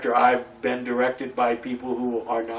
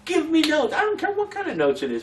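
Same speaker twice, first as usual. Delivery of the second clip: high-pitched, insistent plea; exasperated high-voiced appeal; pleading demand; faster than usual. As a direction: beg for it, pitched way up. Pleading with directors for feedback.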